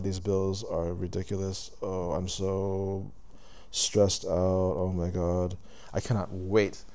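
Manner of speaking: low, quiet, droning complaint; mock weary grumbling. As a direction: act out a stressed-out everyman muttering to himself. Acts out humanity's daily stressed grumbling.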